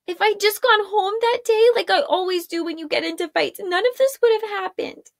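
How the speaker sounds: put-on a young woman near tears; distraught Arella. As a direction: spiral into self-blame.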